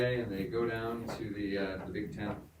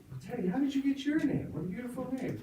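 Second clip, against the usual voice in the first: hammed-up preacher. Preacher welcoming the children at the tent, high and gushing. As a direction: sing it out like an over-sweet preacher, slowing at the end. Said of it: "preacher to Teddy".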